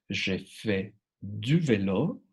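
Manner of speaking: "Je fais du vélo" slow, careful French; French model phrase. Shown as a demonstration. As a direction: say "Je fais du vélo" slowly for students.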